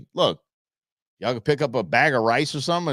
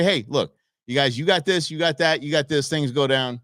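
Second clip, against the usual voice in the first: ordinary talk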